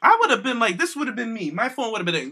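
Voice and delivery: usual speaking voice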